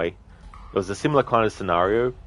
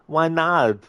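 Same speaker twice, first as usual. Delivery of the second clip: scared henchman; 8 semitones higher, 3 dB louder than usual. Retold or imitated. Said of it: "Arnie movie scene impression".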